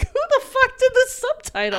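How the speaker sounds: high, loud comic indignation; incredulous outrage. Retold or imitated. Viewer asks who wrote the subtitles, mock-outraged. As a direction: demand it in amused, high-pitched disbelief. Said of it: "I was like".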